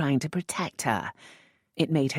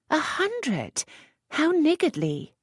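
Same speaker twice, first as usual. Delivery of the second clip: high, scoffing; mock scorn; slower than usual. A narrator's reading. Lisette mocking the earl's offer, high and scoffing. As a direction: outbid him with disdain.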